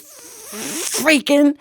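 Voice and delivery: "freaking" with a long hissed F before it pops out; long hung-on F. Retold or imitated. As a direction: stall on the F of "freaking", then finish high. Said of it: "I hung on that F forever".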